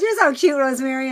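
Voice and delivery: imitated high, loud gush; gushing friend. Laughing friend teases her affectionately, retold in a high, loud gush.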